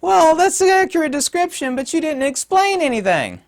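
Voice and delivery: very high, loud mock protest; exasperated retort. Answering people who only describe the golden ratio, exasperated. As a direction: throw it back at them, high-pitched and incredulous.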